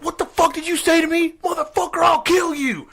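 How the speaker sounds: put-on high-pitched angry old-guy yell; retold screaming. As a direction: scream it like an enraged old man. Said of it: "this old guy's just screaming".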